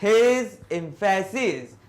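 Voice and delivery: slow, loud, stretched stress on his; drawn-out emphasis on "his"; emphatic reading. Shown as a demonstration. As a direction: lean hard on "his emphasis" slowly. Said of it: "जैसे मैंने ऐसे किया".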